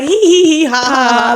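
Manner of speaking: high, loud mock cackle; imitated giggling. Imitating the seller giggling with her, high and loud. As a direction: act out knee-slapping giggles, high and loud. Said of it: "me and her were giggling".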